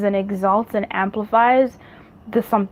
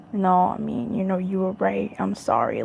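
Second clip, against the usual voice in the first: softer, humbled, sheepish admission; humbled apology; 7 dB quieter than usual. Retold or imitated. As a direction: mumble an apology like someone humbled. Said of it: "comes to you humbled and says".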